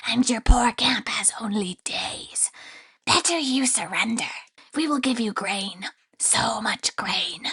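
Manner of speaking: sinister whispering creature voice; creepy grain spirit whisper. Acted.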